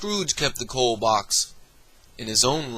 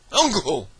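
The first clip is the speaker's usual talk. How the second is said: pleading; 7 semitones higher, 3 dB louder than usual. Acted. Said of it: "pleaded the nephew".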